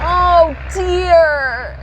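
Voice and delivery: mildly disapproving oh dear; tutting. Acted. Badger to the heap of racers, tutting. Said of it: "tutted Badger".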